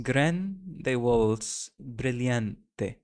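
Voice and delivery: GRANDE WALTZ BRILLANTE slowly, each word and final e sounded; very slow, deliberate GRAN-DE WALTZ BRIL-LAN-TE; every word sounded out. Shown as a demonstration. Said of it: "So you pronounce every word".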